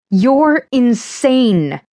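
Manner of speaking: high, slow yell; slow incredulous yell. A narrator's reading. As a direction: yell it out, stretching each word. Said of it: "I heard myself yelling again".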